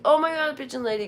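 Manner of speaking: high, fast, thrilled squeal of recognition; excited fan. Retold or imitated. Spotting the pigeon lady in Union Square, thrilled and high. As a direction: gush like spotting a hero. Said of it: "And I was like".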